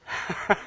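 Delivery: brief breathy chuckle; short laugh. Real, not acted. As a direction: chuckle at your own confession. Laughs at his own admission.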